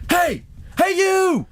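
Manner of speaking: high-pitched mock holler; imitated yelling. Man on a bus top hailing a passing driver, imitated yell. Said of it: "he is yelling at me".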